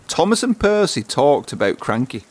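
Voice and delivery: plain reading voice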